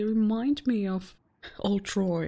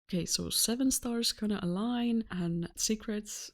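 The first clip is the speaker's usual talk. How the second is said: ordinary talk, nothing marked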